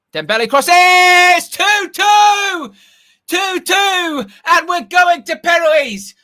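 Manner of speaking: roaring live goal celebration; screaming goal call. Real, not acted. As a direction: erupt as the equaliser goes in. Late equaliser, going to penalties.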